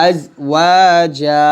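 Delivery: slow, stretch the final আ of আজওয়াজা; আজওয়াজা drawn long; stretched ending. Shown as a demonstration. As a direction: hold আজওয়াজা's last vowel one alif. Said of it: "এক লিফ টান দিব".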